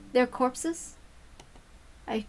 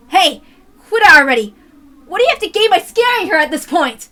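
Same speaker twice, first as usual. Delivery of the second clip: loud, high furious yell; angry shout; 7 semitones higher, 12 dB louder than usual. Acted. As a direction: yell at her to stop.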